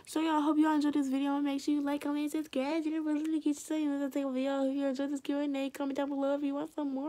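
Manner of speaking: high, loud sign-off; bright energetic goodbye to subscribers; upbeat vlog outro. Acted. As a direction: pump it up for the viewers like a YouTuber.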